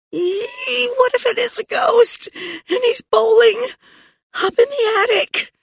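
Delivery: very high, squeaky panic; thin, high-pitched fright; frightened squeal. A narrator's reading. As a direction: squeal it like a scared child. Girl fears a ghost upstairs.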